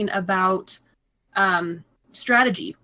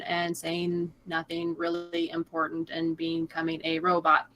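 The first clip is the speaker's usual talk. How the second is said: robotic monotone; 4 semitones lower, 6 dB quieter than usual. Shown as a demonstration. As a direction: drone on slowly with no inflection. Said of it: "you're not talking monotone".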